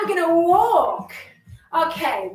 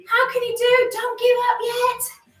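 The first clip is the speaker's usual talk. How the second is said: ordinary talk, nothing marked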